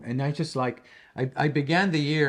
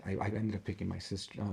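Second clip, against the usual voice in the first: ordinary talk, nothing marked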